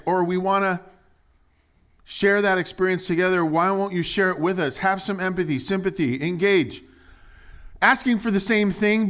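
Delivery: loud, high, driving sermon voice; preaching at full force. Acted. Preacher pressing the crowd on their stubbornness, loud and high. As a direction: push each line to the back row.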